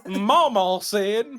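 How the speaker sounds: exaggerated Southern drawl demo. Shown as a demonstration. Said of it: "that's a very southern drawl thing".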